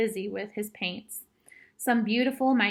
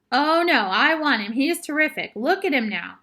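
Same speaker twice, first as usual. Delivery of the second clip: raised, eager insistence; excited refusal; 4 semitones higher, 6 dB louder than usual. A narrator's reading. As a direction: protest excitedly, starting high and loud. Boy refuses to give up cowboy.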